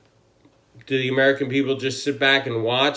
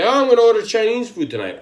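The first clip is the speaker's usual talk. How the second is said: mocking; 9 semitones higher, 5 dB louder, faster than usual. Mocking quote of ordinary talk.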